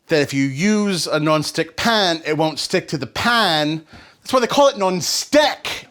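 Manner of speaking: drawn-out, emphatic celebrity chef impression, rising in pitch; Gordon Ramsay. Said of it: "but as Gordon Ramsay says".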